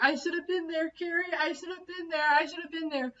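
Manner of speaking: high, wailing mock cry; imitating his sobbing. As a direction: imitate a man crying hysterically. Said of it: "cries hysterically".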